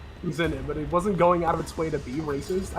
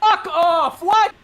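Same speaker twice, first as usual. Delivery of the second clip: outraged shout; 9 semitones higher, 6 dB louder, much slower than usual. Real, not acted. Outraged at a game spoiler.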